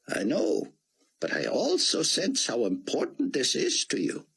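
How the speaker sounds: put-on high, foreign-sounding older gentleman; old Israeli scholar. Rosenzweig voiced by the narrator.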